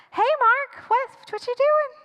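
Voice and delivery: high, falsely casual hello; awkward greeting. Acted. Girlfriend blocking the door greets dad, awkwardly casual. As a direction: chirp it nervously, stammering a little.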